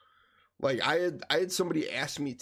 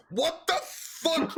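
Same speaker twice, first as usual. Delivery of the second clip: high, drawn-out mock outrage; hammed-up, high-pitched angry bafflement; imitating pissed-off disbelief; 4 dB louder, much slower than usual. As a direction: act out an indignant, stretched exclamation. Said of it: "he's all pissed off".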